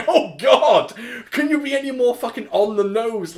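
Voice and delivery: loud, high groan of disbelief; exasperated outburst. Retold or imitated. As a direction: throw it up in loud despair. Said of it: "And it's just like".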